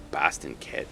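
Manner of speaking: understated Boston accent. Shown as a demonstration. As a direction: try out a Boston accent softly. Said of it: "I can't do Boston right now".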